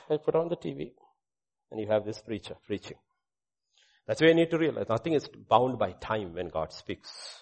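ordinary talk

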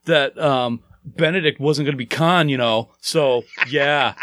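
sulky, whiny complaint; pouty voice. Acted. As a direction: pout your way through it. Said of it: "That was my pouty face voice".

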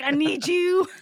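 pleading. Retold or imitated. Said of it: "I'm like".

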